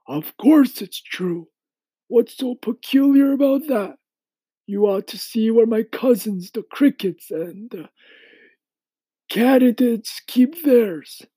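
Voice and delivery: high-pitched insect character voice; high, emphatic storybook elder; old grasshopper, matter-of-fact. Acted.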